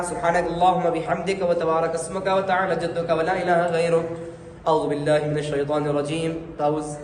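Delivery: steady recited Arabic prayer; teacher reciting the opening prayers; prayer recitation. Shown as a demonstration. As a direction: recite the sana in an even prayer cadence.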